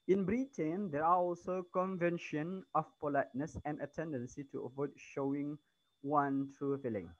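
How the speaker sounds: loud, careful textbook reading; reading aloud. Acted. Teacher reads the English text to her students, loud and careful. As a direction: read the passage out to the whole class.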